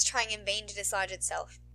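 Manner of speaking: ordinary delivery, nothing marked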